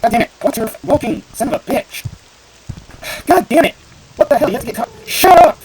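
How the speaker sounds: high, loud screaming rant; raging at a follower who keeps getting caught; furious shout. Real, not acted. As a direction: blow up at the character in the way. NPC blocking the path again.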